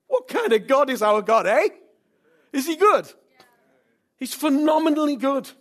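high, loud exultant questions; rousing preaching. Real, not acted. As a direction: fire up the congregation about God.

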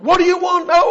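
high, loud call; Jesus calling out. Retold or imitated. Jesus calling to the crowd, loud high call. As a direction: shout the question out. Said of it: "called that same cry out".

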